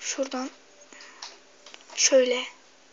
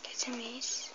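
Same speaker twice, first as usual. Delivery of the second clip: whispering; 14 dB quieter, slower than usual. Real, not acted. Whispered frustration.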